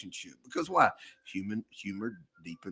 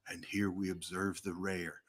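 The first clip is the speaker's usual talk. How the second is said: nature documentary; 4 semitones lower, 6 dB quieter than usual. Shown as a demonstration. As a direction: narrate like a wildlife film, hushed. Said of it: "narrating your commute to work like a nature document".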